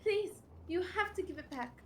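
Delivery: high-pitched distressed victim voice; acted plea, pitched well up; pleading. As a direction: beg for it to be returned. Robbed woman pleading with thief.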